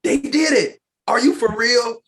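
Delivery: high, loud incredulous yelling; excited reenacted disbelief. Retelling shouting while driving home, excited disbelief. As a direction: yell it out in giddy disbelief. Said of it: "at least two different times I said".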